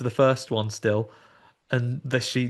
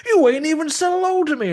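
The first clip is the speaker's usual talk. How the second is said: Southern skeleton scolding rudely, much higher; southern skeleton accent; 6 dB louder than usual. Retold or imitated. Skeleton actor scolding Andy in a Southern accent, high and loud. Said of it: "very southern skeleton".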